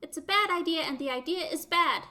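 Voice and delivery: high, loud robot insistence. Acted.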